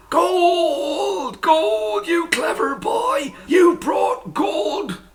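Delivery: very high delighted shouting; joyful shout. A narrator's reading. Mother shouting with joy at the gold. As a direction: shriek with joy over the gold. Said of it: "this time she shouted".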